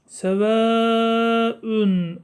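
Sevaün with the aa stretched very long; long madd. Shown as a demonstration. Teacher shows the madd, Sevaün with a long held a. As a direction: draw out the a of Sevaün before ün. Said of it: "Dört elif miktarı çekilir".

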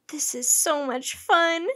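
put-on excited girl having a great time; delighted. A narrator's reading. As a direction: relish the fun, drawing it out. Girl enjoying the bobbing boat.

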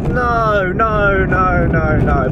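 long falling string of high noes; drawn-out disapproval. Retold or imitated. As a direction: start high and slide down in dismay. Reacting to rival's prep video.

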